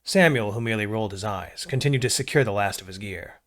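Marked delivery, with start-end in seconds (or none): none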